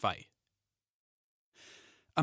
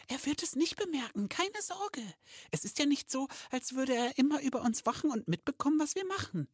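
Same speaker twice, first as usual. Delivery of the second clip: very high squeaky elf voice; reassuring elf girl. Acted. Kistel telling her worried friend not to fret, reassuring, very high. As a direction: reassure in a tiny high-pitched voice.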